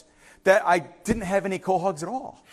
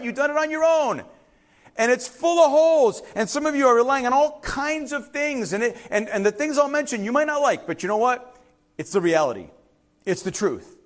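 full preaching; 5 semitones higher than usual. Acted. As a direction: drive it home from the pulpit. Preaching to the congregation.

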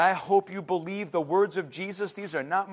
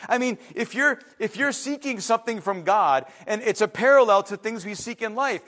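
normal talking voice

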